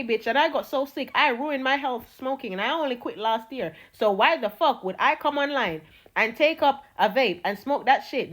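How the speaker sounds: usual speaking voice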